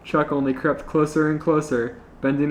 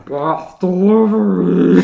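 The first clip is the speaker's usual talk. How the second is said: slow, louder gargled words; gargling through water; 5 semitones higher, 7 dB louder, slower than usual. Acted. Panty busting through the door, gargled slow call. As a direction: speak with a mouthful of water. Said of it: "She busted through the door and said".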